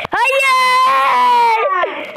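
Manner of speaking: loud high cry of dismay; despairing shout. Real, not acted. Character dies in game.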